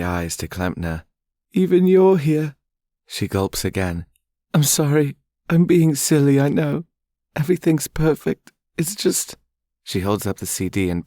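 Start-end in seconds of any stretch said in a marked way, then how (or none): 1.5-2.5 high, loud, crying voice counting her blessings; much higher sobbing voice; tearful young woman. Acted. Charlotte's tearful voice. 4.5-9.4 high, loud, crying voice counting her blessings; much higher sobbing voice; tearful young woman. Acted. Charlotte's tearful voice.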